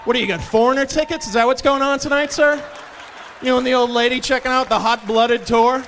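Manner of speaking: loud, high mocking questions; sarcastic taunting. Barista ridiculing customer's seventies slang, loudly sarcastic. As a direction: pile on incredulous mock questions.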